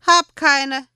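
put-on high, loud small child. A narrator's reading. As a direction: shrug it off as a little boy.

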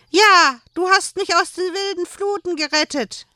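put-on high solemn child voice. A narrator's reading. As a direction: declare it like a proud little child. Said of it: "nickte das Kind feierlich".

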